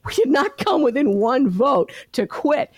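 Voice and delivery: retold surge of determination; defiant resolve. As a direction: rise up and refuse to quit. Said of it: "something in me rose up and said".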